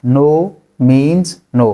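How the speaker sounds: drawn out very slowly and clearly. Shown as a demonstration. Teacher repeats it very slowly for students. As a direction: stretch every word out. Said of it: "नहीं मतलब नहीं".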